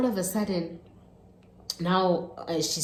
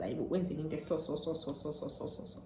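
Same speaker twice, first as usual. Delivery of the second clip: fading off; 8 dB quieter, faster than usual. Retold or imitated. Retelling to gran, trailing away.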